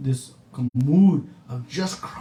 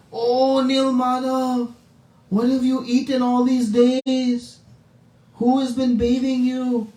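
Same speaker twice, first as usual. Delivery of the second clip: mother after separation; 8 semitones higher than usual. Retold or imitated. Devotees greeting the Lord, like a mother after separation. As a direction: fuss over a long-lost child, pitched high. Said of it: "a mother might speak to her child after a separation".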